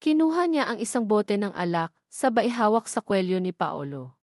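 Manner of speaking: ordinary delivery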